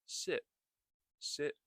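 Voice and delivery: SIT with the T stopped short; SIT ending on a silent T; unreleased final T. Shown as a demonstration. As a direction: hold back the T at the end of SIT. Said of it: "when you begin practicing, you can start saying".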